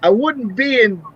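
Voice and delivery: imitated comedian. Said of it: "And he's like".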